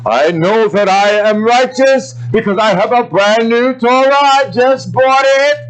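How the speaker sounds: mocking a proud new-Torah owner; mock self-righteous hypocrite. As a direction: play a smug show-off hypocrite. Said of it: "that hypocrisy".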